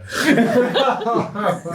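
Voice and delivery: high, loud real laugh. Real, not acted. Player cracking up after the goblin's insult, loud laugh. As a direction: burst out laughing.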